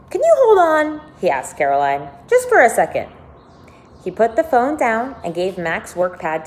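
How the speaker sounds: lively read-aloud narration; expressive storybook reading with lifted quotes; story reading. A narrator's reading. Reads novel aloud to listeners.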